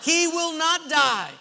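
high, slow, booming declaration; mock-prophetic proclamation. Husband proclaims Rambo's survival to wife, mock-prophetic preaching. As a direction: build to a drawn-out hammed-up final name.

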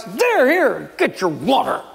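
high, spiteful outburst dropping at the end; hammed-up angry Moses; acted anger. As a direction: throw it at them in a temper. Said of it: "He was doing it out of anger".

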